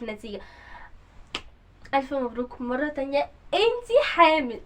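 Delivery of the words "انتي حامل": slow, louder dramatic announcement; dramatic reveal. Acted. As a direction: land the news with weight.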